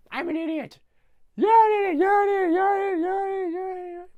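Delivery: high, loud, rising to a shrieking cry; arguing with echo. Retold or imitated. The Grinch argues with his echo until he screams. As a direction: bicker with your own echo, losing it.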